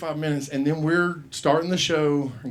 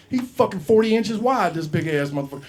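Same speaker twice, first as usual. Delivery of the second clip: ordinary talk, nothing marked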